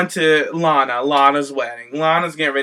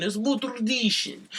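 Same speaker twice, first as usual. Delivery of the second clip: imitating Rusev; 7 semitones higher, 7 dB quieter than usual. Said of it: "He's like".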